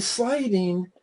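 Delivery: usual speaking voice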